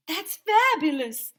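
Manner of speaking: high, slow, drawn-out fabulous; gushing photographer. A narrator's reading. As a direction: savour it like a diva photographer. Miss Joni gushing.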